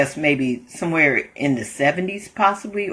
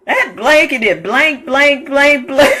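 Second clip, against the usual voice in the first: higher, louder fake curse words; mock cussing; 9 semitones higher, 5 dB louder than usual. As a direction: spit out blanks in place of curses. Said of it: "that's what he's saying in place of the cuss word".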